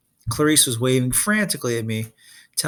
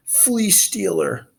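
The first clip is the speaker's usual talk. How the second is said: high, slow spluttering threat; spluttering Cyclops; 8 semitones higher, much slower than usual. Acted. Soaked Polyphemus splutters at Percy, high and slow. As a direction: choke the words out slowly. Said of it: "Polyphemus spluttered".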